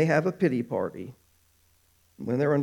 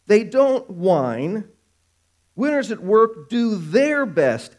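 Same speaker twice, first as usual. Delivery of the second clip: raised, emphatic sermon voice; preaching; 5 semitones higher, 3 dB louder, slower than usual. Acted. Preacher urges the congregation not to grumble, emphatic preaching. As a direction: drive each "don't" home, slowly.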